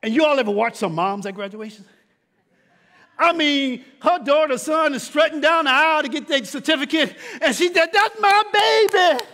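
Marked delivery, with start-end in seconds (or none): 3.2-7.9 loud, high preacher's build; full-force preaching. Acted. Preacher rousing the congregation about mamas, loud preaching. 7.9-9.2 high, loud, slow proud mother's cry; proud mama. Retold or imitated. Mother at graduation calling out, high loud proud cry.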